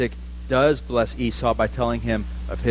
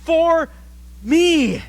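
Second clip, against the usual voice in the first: crying out. Retold or imitated. Grown son crying out to his father for help, mock wail. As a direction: cry out to your father for help. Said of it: "weeping uncontrollably and crying out".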